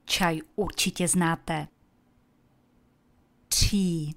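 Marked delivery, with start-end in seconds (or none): 3.5-4.1 Tea with a strong breathy puff on the T; aspirated T. Shown as a demonstration. Teacher models Tea for learners, breathy aspirated T. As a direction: blow air out on the T of Tea. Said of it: "Pozor na přídech u hlásky T".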